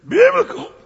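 BIBLICAL cried out high and loud; high, loud imitated pulpit cry on "Biblical"; fired-up preacher. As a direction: belt "Biblical" like a gospel preacher. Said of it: "he didn't say biblical. He said".

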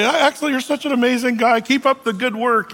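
high, bright-eyed flattery; lightly voiced starstruck guest; gushing guest. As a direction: fawn over him without listening.

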